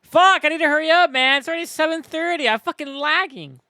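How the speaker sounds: high, loud, rapid outburst; frustrated urgency. Real, not acted. Streamer running late and lagging, urgent and frustrated.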